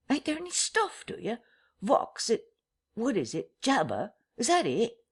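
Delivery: a vagrant groping for words; bewildered rustic tramp. Acted.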